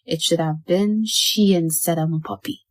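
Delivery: ordinary delivery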